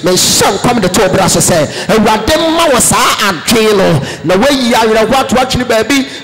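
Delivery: loud, fervent prophetic declaration; fiery sermon climax; preaching at full force. Acted. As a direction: proclaim the blessing over the congregation.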